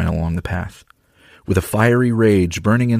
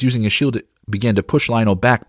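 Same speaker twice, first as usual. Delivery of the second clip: ordinary delivery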